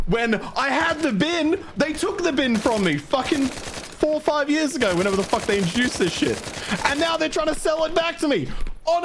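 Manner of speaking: venting pure exasperation about the bins; outraged high rant. Real, not acted. Escalating rant about bin subscription.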